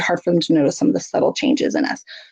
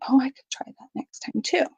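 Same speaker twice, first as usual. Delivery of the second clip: put-on child's whisper; 6 semitones higher, 6 dB quieter than usual. Child copying parent's coping.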